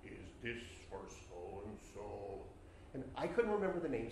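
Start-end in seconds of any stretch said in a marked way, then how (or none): 0.1-2.4 low, slow graveside stranger; eerie stranger. Acted. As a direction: ask it slowly, low in pitch and softly.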